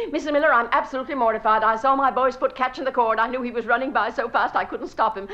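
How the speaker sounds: loud, rapid gushing apology; flustered apology. Acted. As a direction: pour out apologies breathlessly to the hostess. Mortified apology to hostess.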